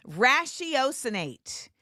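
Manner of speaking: "Ratiocinate" slowly, as rash-ee-OH-suh-nate; careful pronunciation. Shown as a demonstration. Streamer teaching the word of the day, "Ratiocinate" said slowly as rash-ee-OH-suh-nate. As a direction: stretch out "Ratiocinate" syllable by syllable. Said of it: "The word is ratiocinate".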